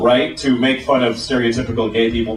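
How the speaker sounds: normal talking voice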